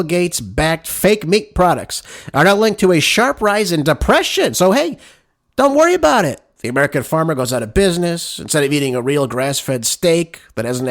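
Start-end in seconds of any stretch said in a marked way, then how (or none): 4.5-6.4 high-pitched mock-breezy dismissal; sarcastic reassurance. Real, not acted. Host mocking calm about fake meat risks, high sarcastic reassurance. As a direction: wave it away sarcastically, voice pitched up.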